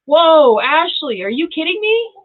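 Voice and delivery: high, loud stunned disbelief; can't believe it; stunned whoa. Real, not acted. Reader stunned by viewer's comment, high disbelief. Reading hits home, surprised.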